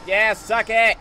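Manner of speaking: loud, high gloating yell; loud taunting cheer; triumphant shout. Real, not acted. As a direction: shout it at a beaten enemy. Gloats at the game enemy.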